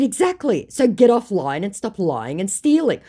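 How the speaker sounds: high, emphatic telling-off; firm scolding. Retold or imitated. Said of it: "I said".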